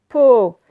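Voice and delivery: "Po" punched out loud; stressed syllable. Shown as a demonstration. Teacher isolating the syllable with O, loudly stressed. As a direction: hit "Po" hard on its own. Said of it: "Encore po".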